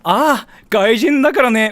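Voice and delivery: high, loud, knowing sigh of a phrase; Japanese: 見下したような「外人だから」; dismissive Japanese remark. Retold or imitated. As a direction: say it like a local waving him off. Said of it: "you'll hear this one phrase".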